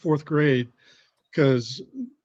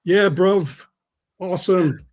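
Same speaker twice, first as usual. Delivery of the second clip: put-on British accent, higher in pitch; 4 semitones higher, much slower than usual. Shown as a demonstration. Guest briefly revives his British accent for the hosts, British accent. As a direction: do a casual British bloke accent. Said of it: "let me use my British accent for a minute".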